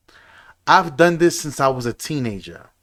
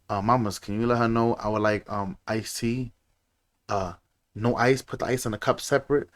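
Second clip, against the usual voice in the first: flat aside to wife; 5 semitones lower, 9 dB quieter than usual. Retold or imitated. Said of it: "look dead at my lady".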